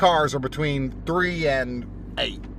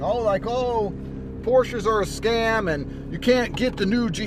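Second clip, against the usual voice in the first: high, drawn-out mock complaint; mock complaining; 7 semitones higher than usual. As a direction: imitate a broke complainer, pitched up and slow. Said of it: "by some brokey complaining".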